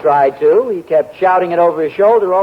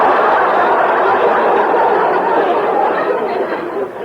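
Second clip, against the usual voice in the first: high, breathy chuckle; amused at his wife's curiosity; long laugh; 13 semitones higher than usual. Acted. Father laughing at wife.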